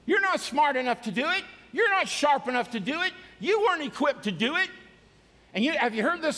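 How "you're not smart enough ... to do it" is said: loud jeering accuser, high and pushed; Satan's taunting voice. Acted. Preacher voicing Satan's taunts to the church. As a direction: sneer the doubts like the devil. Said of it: "Satan... will speak into their lives and say".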